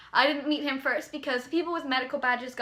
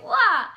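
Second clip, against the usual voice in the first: loud high playful "Wah!" burst; playful shout; 9 semitones higher, 8 dB louder than usual. Retold or imitated. Jack pops out from behind the curtain, loud high shout.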